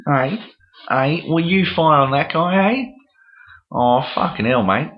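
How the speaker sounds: hammed-up Aussie, high-pitched and lilting; exaggerated Aussie mock accent; Australian accent. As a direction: play a broad Australian gamer calling out. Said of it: "talk with an Australian accent".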